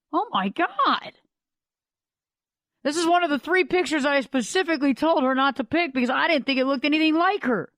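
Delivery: high-pitched, appalled disbelief; acted dismay. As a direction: act out a photographer aghast at the sight. Photographer sees the rejected picture.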